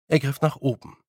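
ordinary delivery, nothing marked

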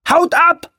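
very high, loud warning scream. Acted. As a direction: scream at them to run. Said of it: "schrie er".

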